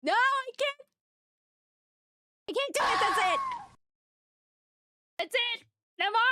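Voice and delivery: high-pitched, real overwhelmed protest; overwhelmed pleading. Real, not acted. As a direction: plead for it to stop, high and breaking off. Begging gifters to stop, overwhelmed.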